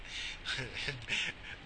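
soft, breathy chuckle; real laughter. Real, not acted. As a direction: quietly crack up at yourself. Laughs at own blunder.

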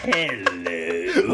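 low, growled greeting; death metal voice. Shown as a demonstration. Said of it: "I go".

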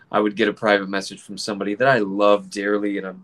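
ordinary delivery

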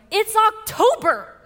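high, loud, drawn-out mock disbelief; retold outburst. Wife reacting to news of an early Christmas present, loud mock outrage. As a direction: act out an exasperated yelp, stretching the words. Acted outraged reply to husband.

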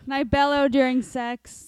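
loud joking boast; mock bellow. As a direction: boom it out for laughs. Joking reading of character traits.

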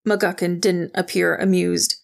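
ordinary delivery

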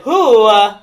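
loud "who", then a halting "uh"; botched accent sliding into a celebrity voice; Christopher Walken. Acted. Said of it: "no, that's Christopher Walken".

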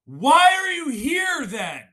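high, loud, stretched-out yell; outraged shout, slow and piercingly high; screamed demand. Acted. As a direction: scream it to haunt a phone user. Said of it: "hear my voice".

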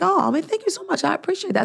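high-pitched retold gratitude; imitating an effusive thank-you; gushing thanks. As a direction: gush your thanks, pitched well up. Retelling her grateful thanks to barista.

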